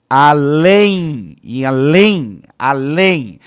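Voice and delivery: "além" ending in a nasal ei glide, loud and slow; teacher demonstrating the nasal glide; nasal diphthong demo. Shown as a demonstration. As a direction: stretch "além" so the hidden i is heard. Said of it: "Isso vai acontecer".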